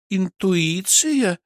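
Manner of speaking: high, drawn-out sceptical echo; stretched, questioning disbelief; doubtful. Acted. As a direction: repeat it slowly, full of doubt. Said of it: "с сомнением посмотрела на меня Кристина".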